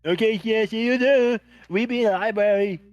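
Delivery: loud, high-pitched in-character reply; calling out. Acted. Player answering Scath through the bracelet, calling out loudly. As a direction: holler it back down the line.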